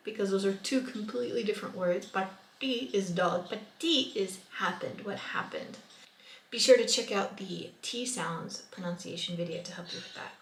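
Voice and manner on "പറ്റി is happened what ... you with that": ordinary talk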